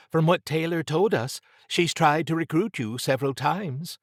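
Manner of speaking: high voice of a sceptical colleague; Trish, questioning. A narrator's reading. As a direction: pitch it well up, gently challenging.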